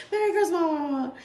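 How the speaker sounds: can't say it without laughing. Real, not acted. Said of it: "let's try this again".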